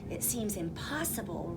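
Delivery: plain reading voice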